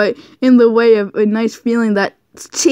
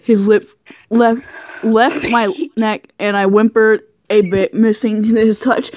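ordinary delivery, nothing marked

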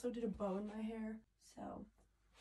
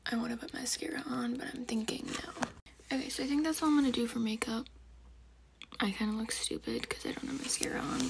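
whispering; 8 dB louder than usual. Real, not acted. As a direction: keep it all in a whisper. Whispers the whole video.

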